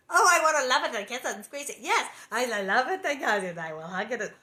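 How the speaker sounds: loud, hammed-up gushing; mock gushing. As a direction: gush in exaggerated adoration, starting high and settling. Mock gushing over wanting the baby.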